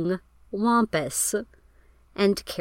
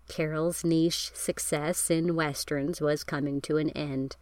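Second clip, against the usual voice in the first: ordinary talk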